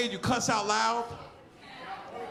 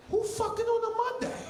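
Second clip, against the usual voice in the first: incredulous; 12 semitones higher than usual. Retold or imitated. Incredulous retort.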